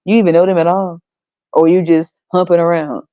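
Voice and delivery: usual speaking voice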